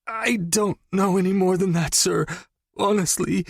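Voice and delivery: high, pleading voice; begging. Acted. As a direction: beg for mercy. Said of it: "he begged".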